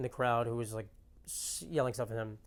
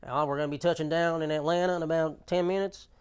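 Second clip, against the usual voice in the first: put-on Southern pilot accent; 3 semitones higher, 3 dB louder than usual. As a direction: play the relaxed Southern captain announcing the landing. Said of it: "those men with southern accents".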